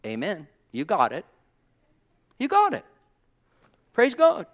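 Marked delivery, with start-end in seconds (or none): 2.4-4.5 high, loud cry of praise; exultant exclamation. Real, not acted. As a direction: call it out way up high, punchy and short. Repeats it as an exclamation.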